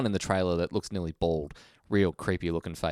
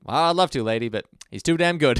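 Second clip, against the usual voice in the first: bailiff; 6 semitones higher, 5 dB louder than usual. Retold or imitated. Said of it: "type him".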